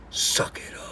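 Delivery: whispered Suck it up, no voice. Real, not acted. Whispering Suck it up to the absent friend. Pep talk to friend Joe.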